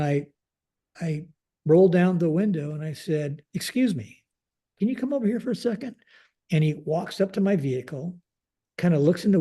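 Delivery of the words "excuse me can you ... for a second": higher-pitched call to someone outside; calling over. Retold or imitated. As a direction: politely summon a passer-by from the car window. Calls airman from car window.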